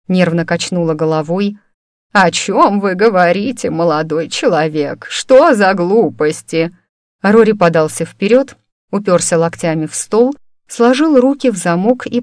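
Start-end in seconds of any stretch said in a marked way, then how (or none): none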